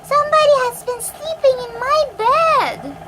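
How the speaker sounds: high, loud mama bear voice; mother bear raising her voice, pitched well up; gentle Mother Bear. A narrator's reading. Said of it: "gentle voice".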